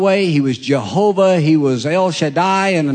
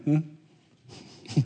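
imitating Corey; 9 dB quieter than usual. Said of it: "That's how Corey gets excited".

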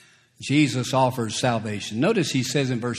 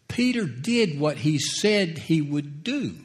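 usual speaking voice

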